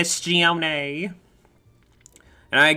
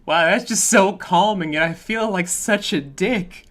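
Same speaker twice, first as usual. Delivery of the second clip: stunned reaction; 5 semitones higher, 4 dB louder than usual. Retold or imitated. Acted reaction quote.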